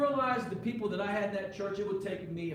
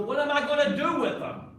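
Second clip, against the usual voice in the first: loud, much higher cry of exasperation; exasperated outburst; 9 semitones higher, 8 dB louder than usual. Real, not acted. Pastor asking what to do with thousands, loud exasperation. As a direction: throw the question up at the rafters. Preacher exclaiming on crowds.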